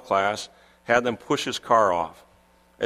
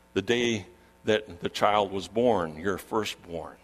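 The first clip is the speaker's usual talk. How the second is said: softer, low and solemn; subdued pastoral reflection with long pauses; hushed, grave preaching; 3 semitones lower, 6 dB quieter than usual. Acted. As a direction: drop the voice to speak gently of dark days. Preacher turns quiet and grave.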